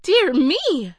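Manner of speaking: high, loud surprised gasp of joy; suddenly cured and delighted; exclamation. Acted. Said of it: "she exclaimed".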